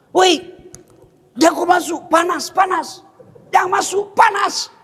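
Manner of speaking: shouted warning. Retold or imitated. Villager at the lift yelling a warning at a Chinese man entering. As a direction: yell at him to stay out, alarmed.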